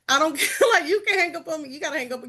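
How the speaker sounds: real laughter, amused defiance; laughing. Real, not acted. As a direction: giggle while you shrug it off. Laughing about hang-ups.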